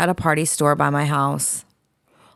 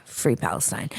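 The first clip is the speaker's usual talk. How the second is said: breathy whisper, no voice; 8 semitones lower, 8 dB quieter than usual. Shown as a demonstration. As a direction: whisper it low and soft. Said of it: "sign-off repeated twice".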